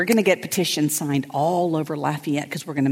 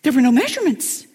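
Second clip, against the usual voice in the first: much higher, louder excited retelling; higher breathless friend voice; high flustered friend; 10 semitones higher, 3 dB louder than usual. Retold or imitated. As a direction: bubble it out in her high voice. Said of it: "And she goes".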